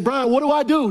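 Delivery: put-on pleading young athlete; eager question. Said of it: "I said".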